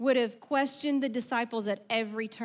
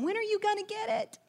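much higher, pleading exasperation; exasperated parent; 9 semitones higher than usual. Retold or imitated. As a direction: ask it like a worn-out parent.

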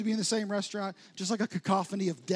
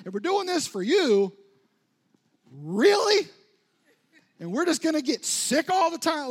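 louder, higher exasperated complaint to God; frustrated rant at God; 5 semitones higher, 6 dB louder than usual. Retold or imitated. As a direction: vent it all, building to an outraged really. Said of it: "I'm just pretty frustrated".

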